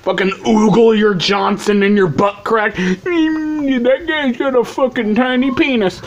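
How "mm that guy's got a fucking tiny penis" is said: high-pitched caricature of a gawking screener; mocking TSA employee. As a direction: put on a silly higher voice, as if ogling a scan. Mocking airport screener ogling scan.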